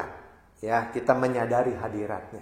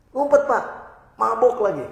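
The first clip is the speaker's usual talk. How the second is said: high, urgent, louder hide-now warning; panicky warning; 10 semitones higher, 5 dB louder than usual. Retold or imitated. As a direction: hiss the danger up high, pressing. Employee warns boss to hide.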